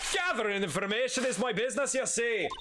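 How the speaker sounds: high, hammed-up character voice; put-on villain voice. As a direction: play the villain pitched well up. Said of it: "That's the one we're sticking with".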